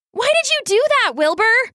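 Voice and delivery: very high, rapid indignant question; upset demand. A narrator's reading. Sister confronting her brother after the chase, upset. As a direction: fire it out fast and high-pitched.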